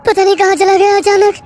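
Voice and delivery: high, loud, almost sobbing insistence; plead, voice cracking; desperate near tears. Acted. Narrator desperate, near crying.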